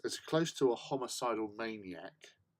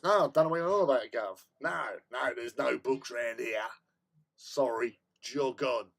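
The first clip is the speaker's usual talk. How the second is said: mock shopkeeper refusal; 7 dB louder than usual. App voiced as a cheeky shopkeeper refusing the book, loud and fast. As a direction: play the app as a cheeky shopkeeper shooing him off.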